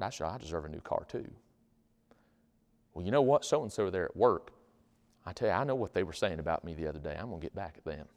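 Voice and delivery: low, soft grumble to oneself; quietly stew over a grudge; resentful brooding. Acted. Acts out brooding, resentful inner thoughts.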